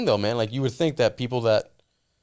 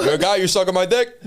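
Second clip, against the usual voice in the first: mock drunk tough guy; 5 semitones higher, 5 dB louder than usual. Playing drunk Alec Baldwin bit.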